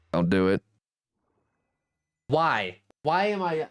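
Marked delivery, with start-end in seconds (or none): none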